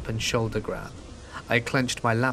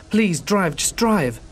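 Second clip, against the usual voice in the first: higher, louder desperate plea to flee; urgent yell; 8 semitones higher, 5 dB louder, slower than usual. A narrator's reading. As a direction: yell it at the driver, begging them to go. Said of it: "yelling at the driver".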